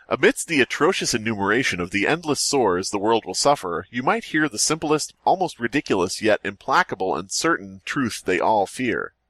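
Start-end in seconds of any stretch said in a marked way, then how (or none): none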